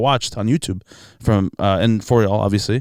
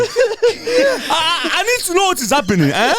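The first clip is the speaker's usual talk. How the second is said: put-on Nigerian accent; slower than usual.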